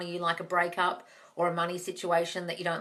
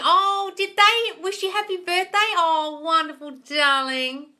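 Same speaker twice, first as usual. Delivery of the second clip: high, loud, slowed delight; drawn-out high excited praise; delighted gush; 9 semitones higher, 8 dB louder, slower than usual. Real, not acted. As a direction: coo over the good news. Reacting to birthday wish.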